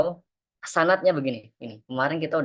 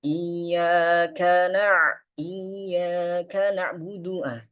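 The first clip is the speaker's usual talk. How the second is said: slow, higher chanted tilawah; Quran recitation; 4 semitones higher, much slower than usual. Shown as a demonstration. Teacher recites the Fatihah verse for the class. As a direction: recite slowly and calmly.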